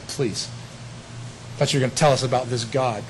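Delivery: soft, scoffing brush-off of the resurrection; a lightly voiced sneer, waving it away; mocking dismissal. Athenian scoffers brushing off Paul, in a soft mocking voice. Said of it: "from those that said".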